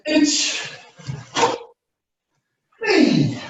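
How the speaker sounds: crisp counted kata calls, pitched high; sharp martial arts commands. Acted. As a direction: snap out each move name like a sensei. Instructor calling kata commands.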